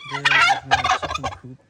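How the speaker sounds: very high, loud and quick squeak; squeaky high voice. Acted. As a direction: show it off in a tiny squeaky voice. Playful voice showing the coop.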